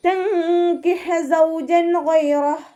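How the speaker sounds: high, slow chanted verse; recitation demo. Shown as a demonstration.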